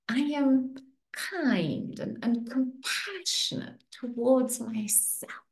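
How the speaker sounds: slow, sweet and soft affirmation; sweetest voice. Shown as a demonstration. Coach models a self-kindness affirmation in her sweetest voice. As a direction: say it as sweetly as you can, drawn out. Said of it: "say it in your sweetest voice".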